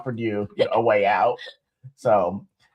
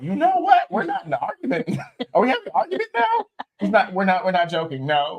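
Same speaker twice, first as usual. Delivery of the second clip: much higher, quick mock disbelief with a laugh; incredulous protest; 7 semitones higher than usual. Husband retelling his own reaction to his wife, high mock disbelief. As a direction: squeak out a flustered denial that this is a fight. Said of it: "I was like".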